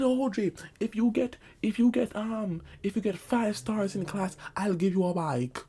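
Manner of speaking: a hint of mom bargaining sweetly; mother's promise. Mother's promise voiced in story.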